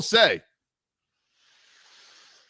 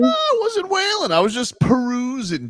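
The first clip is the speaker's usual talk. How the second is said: high protest; 10 semitones higher than usual. Real, not acted. Denying the wailing charge.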